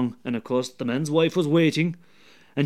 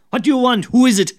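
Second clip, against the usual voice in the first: loud, higher shout from an upstairs window; woken neighbour calling; 8 semitones higher, 8 dB louder, faster than usual. Acted. Neighbour shouting down at the woman who woke him. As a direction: bark it down from the window.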